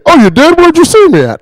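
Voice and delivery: high, loud feigned surprise. Acted. Coworker dodging being seen at the weekend, feigned surprise.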